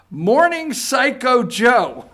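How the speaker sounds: delighted laughing jab. Real, not acted. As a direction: savour the insult with a laugh. Gleeful laughing quote of nickname.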